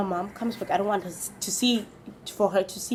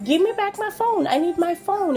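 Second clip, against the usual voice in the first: demanding phone back; 7 semitones higher than usual. Retold or imitated. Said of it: "I'm like".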